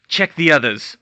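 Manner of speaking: loud curt order; cold command. Acted. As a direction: snap the order. Lucius gives cold order.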